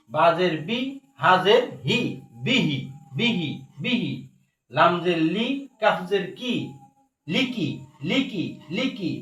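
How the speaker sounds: rhythmic Quran-class recitation drill; spelling drill. Shown as a demonstration. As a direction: spell with rising ends, then repeat the word in falling lilt. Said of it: "একবার বানান তিনবার উচ্চারণ করে".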